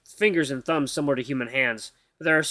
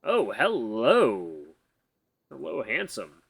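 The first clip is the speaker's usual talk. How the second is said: greeting the huge husky with a flirty tease; mock flirty; 4 semitones lower, much slower than usual. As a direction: purr a joking come-on.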